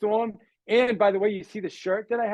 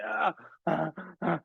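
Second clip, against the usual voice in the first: choking gasps while swimming; mock drowning gasps; gasping splutter; 7 dB quieter than usual. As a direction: splutter like water is in your nose. Swallowing water, struggling.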